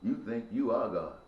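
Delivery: ordinary talk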